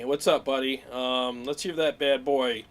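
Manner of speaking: ordinary talk, nothing marked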